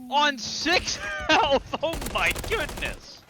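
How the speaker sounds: very high, loud, drawn-out words; amazed shouting. Real, not acted. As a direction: shout in disbelief at the play. Amazed at a low-health play.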